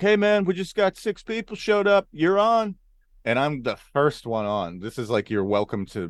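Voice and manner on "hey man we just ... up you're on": high, raised door guy's call. Retold or imitated. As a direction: summon the next comic to the stage. Door guy calls comedian to stage.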